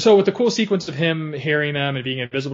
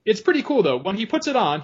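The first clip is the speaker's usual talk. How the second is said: normal talking voice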